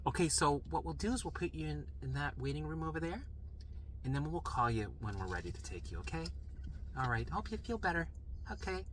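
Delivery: gently placating, imitating the nurse; polite dismissal. Nurse sending patient to the waiting room, soft politeness.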